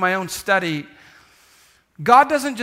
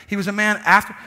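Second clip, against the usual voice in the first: ordinary delivery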